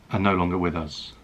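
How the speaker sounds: ordinary talk, nothing marked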